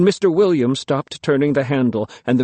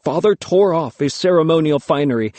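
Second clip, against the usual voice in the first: usual speaking voice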